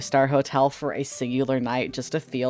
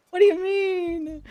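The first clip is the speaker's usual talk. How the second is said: mock offended; slower than usual.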